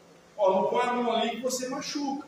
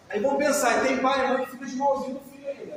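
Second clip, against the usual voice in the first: ordinary talk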